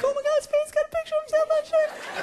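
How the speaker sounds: put-on very high, squealing kid voice; exaggerated high-pitched jeering; mocking schoolkids. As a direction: mimic gleeful kids spreading gossip. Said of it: "They're like".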